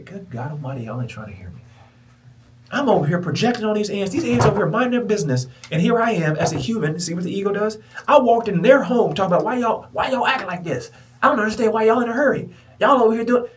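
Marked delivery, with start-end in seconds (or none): 2.7-13.5 high, loud, worked-up ranting; loud mock rant. Mocking himself nagging the ants, loud high rant. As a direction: ham up his own flustered nagging. Retelling his pushy self loudly.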